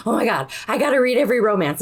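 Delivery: gasp, then fast and high; sudden thrilled realization; gasping excitement. Retold or imitated. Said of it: "I'm like".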